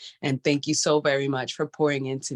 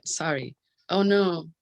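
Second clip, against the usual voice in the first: normal talking voice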